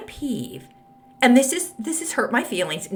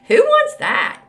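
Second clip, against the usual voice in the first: pitched way up and louder on wants; emphatic outburst; 14 semitones higher, 8 dB louder, slower than usual. Real, not acted. Podcaster scoffing at listener's dull husband, high loud disbelief. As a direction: ask it high and loud, incredulous.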